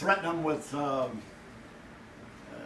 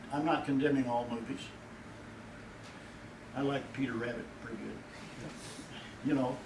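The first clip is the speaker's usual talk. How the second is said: ordinary talk, nothing marked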